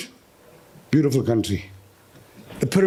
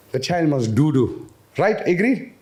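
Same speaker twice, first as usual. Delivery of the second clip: usual speaking voice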